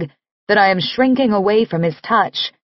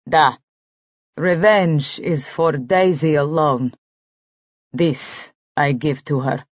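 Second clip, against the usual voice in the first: put-on Russian accent; 5 semitones lower than usual. Nick speaks in Russian accent.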